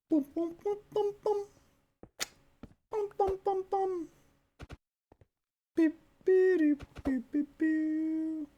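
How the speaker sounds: high nasal horn blasts; air horn imitation. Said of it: "I've been practicing that sound".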